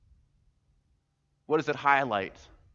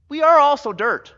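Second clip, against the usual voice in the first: much higher and louder, hammering the point; emphatic shout; 12 dB louder than usual. Real, not acted. Preacher shouting to the congregation that we are dirt. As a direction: cry it out to the whole room.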